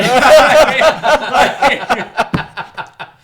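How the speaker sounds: loud high laugh. Real, not acted. Big laugh at the joke.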